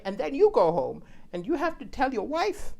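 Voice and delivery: ordinary delivery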